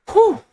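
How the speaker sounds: high, loud exhaled whew; big breathy whew of relief; relieved whew. Real, not acted. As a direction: blow out the tension after the scene. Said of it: "reacting to heavy scene".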